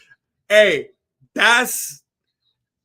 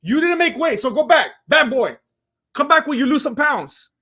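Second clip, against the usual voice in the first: higher, louder shooing voice of a guard; mock security guard; 7 semitones higher, 4 dB louder than usual. Guards sending Anthony Smith back, higher mock shooing voice. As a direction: wave him off like a bouncer. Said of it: "the security guards are like".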